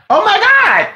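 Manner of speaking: excited gasp. Real, not acted. Excited by new name idea.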